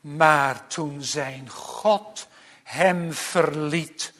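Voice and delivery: ordinary delivery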